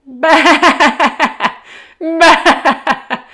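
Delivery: high, loud bleating laugh; laughing sheep. Acted. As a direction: bleat Bah through a big laugh. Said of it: "laughed the sheep".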